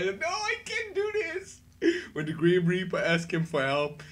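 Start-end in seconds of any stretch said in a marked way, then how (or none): 0.0-1.6 high wailing refusal; mock panicked falsetto; panicked high cry. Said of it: "but he says".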